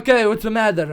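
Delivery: put-on loud, high Russian-accented doctor; imitated Russian accent, blaring question. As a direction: ask it as a booming Russian doctor. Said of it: "like, Russian accent".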